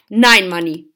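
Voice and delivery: loud, firm objection; calling out firmly. A narrator's reading. Father stopping the name-calling, calling out firmly. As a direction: cut in loudly to stop it. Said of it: "rief Papa".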